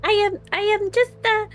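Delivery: high little rat voice, pleading; squeaky rat voice. Acted. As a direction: squeak sweetly like a tiny rat. Player voicing her rat form.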